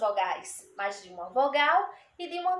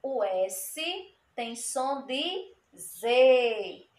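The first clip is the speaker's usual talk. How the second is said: 1 slow; much slower than usual. Shown as a demonstration. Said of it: "explains to children slowly".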